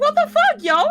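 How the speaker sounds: high, loud, incredulous yelp of outrage; retold outrage. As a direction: fling it out loud and high, furious and disbelieving. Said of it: "I was so mad about this".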